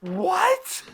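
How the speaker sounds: very high rising "What?"; hammed-up incredulous question; baffled disbelief. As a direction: squeak it out in mock bewilderment. Baffled reply to accented offer.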